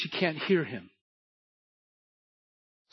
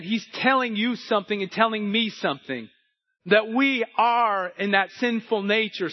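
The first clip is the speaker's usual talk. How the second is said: louder, rising sermon drive; building preaching; 4 semitones higher, 4 dB louder than usual. Acted. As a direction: preach it with growing force.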